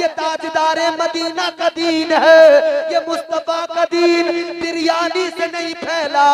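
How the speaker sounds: high, loud and slow sermon cry; impassioned preaching. Acted. Preacher crying out to the faithful, slow and loud. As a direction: lift the crowd with a drawn-out preaching call. Preacher rising to full force.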